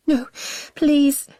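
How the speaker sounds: strained high pleading plea; squeezed out. A narrator's reading. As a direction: force the plea out through a choked throat. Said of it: "she manages to squeeze through".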